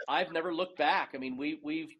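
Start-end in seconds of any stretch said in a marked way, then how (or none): none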